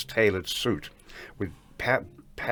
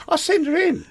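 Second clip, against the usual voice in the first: laughing; 4 dB louder than usual. Retold or imitated. Margot's reply, retold laughing.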